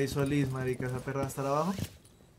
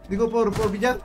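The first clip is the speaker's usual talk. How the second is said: battle orders; 6 semitones higher, 6 dB louder than usual. Real, not acted. Calling enemy position mid-round.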